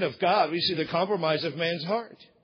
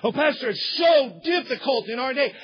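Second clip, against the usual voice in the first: higher, louder, hammed-up lament; mock whining churchgoer; 6 semitones higher, 5 dB louder than usual. Pastor imitating a churchgoer's complaint, high mock whine. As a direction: moan it like a self-pitying believer.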